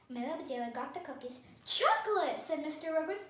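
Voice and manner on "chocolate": high, loud burst of delight; delighted exclamation. A narrator's reading. Mister Ruggers seeing his favorite cookies, delighted.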